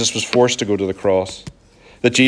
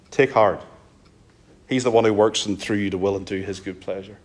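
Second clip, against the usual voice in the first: usual speaking voice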